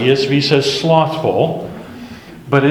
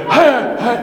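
high, awkward little laugh; sheepish laugh; 10 semitones higher than usual. Retold or imitated. Deflated passenger laughing at himself before the clerk, sheepish laugh. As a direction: let the bluster collapse into an embarrassed chuckle.

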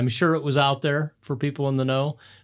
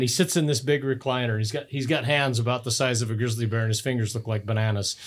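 ordinary talk

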